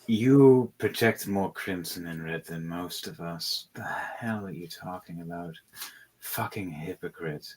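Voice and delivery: low, soft, sneering queen fading to a hiss; a queen muttering contempt under her breath; scornful queen. Acted. Crimson queen to the wizard, sneering low. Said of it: "crimson queen going".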